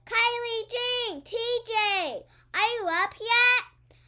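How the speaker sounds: loud, slow, high call upstairs; shouting. Acted. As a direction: call out to wake the kids. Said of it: "Mama shouts".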